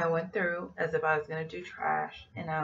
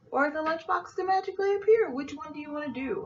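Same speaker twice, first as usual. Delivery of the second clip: high, fast sing-song threat; sassy ultimatum; 11 semitones higher, 4 dB louder, faster than usual. Retold or imitated. Attendant gives the thief a sassy ultimatum, high and fast. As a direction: tease her with the choice.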